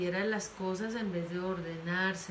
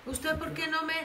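higher, louder angry reproach; scolding parent; 6 semitones higher, 4 dB louder than usual. Retold or imitated. Parent shaming son in public, angry scolding. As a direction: scold him in front of everyone.

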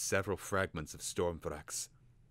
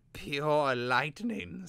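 awed Vilnix whisper-slow; 8 semitones higher, 5 dB louder, much slower than usual. Acted.

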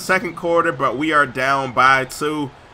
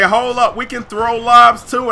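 a lightly higher, louder cocky voice; playful taunt from the other team; mock Clippers boast; 6 semitones higher, 5 dB louder than usual. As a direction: voice the rival team bragging. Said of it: "The Clippers say".

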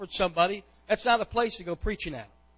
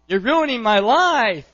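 loud, drawn-out mock wail; hammed-up daughterly outburst, slow and loud; imitating dramatic girls; 3 semitones higher, 9 dB louder, slower than usual. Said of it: "the girls, they have a tendency to use this".

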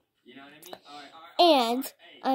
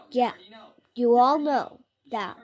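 ordinary delivery

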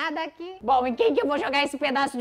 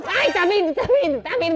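alarmed cry; 5 semitones higher, 7 dB louder than usual. Real, not acted. Chaser coming.

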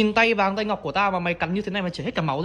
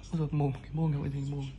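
fond murmur; 6 semitones lower, 11 dB quieter, faster than usual. Real, not acted. Soft fond scolding of mouth.

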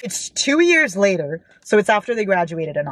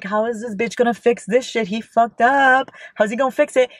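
higher-pitched incredulous griping; retold exasperation; 4 semitones higher than usual. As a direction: replay your exasperated disbelief. Retells exasperated reaction while reading.

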